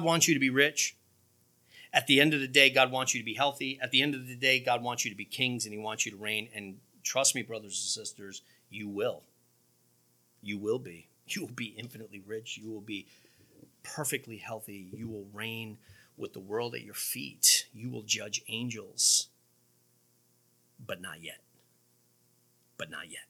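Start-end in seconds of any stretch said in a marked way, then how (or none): 1.9-19.3 low, softened promise; tender assurance. Real, not acted. Preacher assures brothers and sisters of what's coming, low and soft. 20.8-23.3 very low, very soft; hushed caveat. Real, not acted. Preacher cautions the congregation after the promise, hushed and low.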